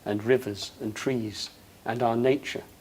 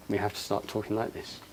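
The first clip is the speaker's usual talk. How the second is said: softer, intimate, leaning in; soft-spoken demo; 3 semitones lower, 11 dB quieter than usual. Shown as a demonstration. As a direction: say it gently, as the example itself.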